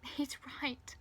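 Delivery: mildly tearful, high-pitched voice; crying. A narrator's reading. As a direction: admit defeat close to tears. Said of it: "she cries".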